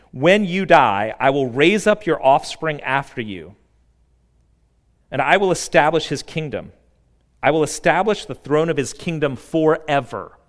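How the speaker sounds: preaching at full force; preaching scripture. Acted. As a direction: proclaim it like a herald. Reading God's promise with force.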